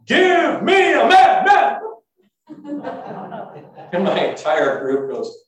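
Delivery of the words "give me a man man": high, slow shout with last word echoed; echoing yell. Acted. The challenge echoes over the silent riverbank, shouted. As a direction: bellow it like a challenge ringing back. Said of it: "and it sounded like".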